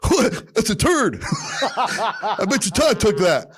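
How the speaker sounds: high and laughing through the words; laughing grandpa. Retold or imitated. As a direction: crack up while delivering the line. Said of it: "he goes".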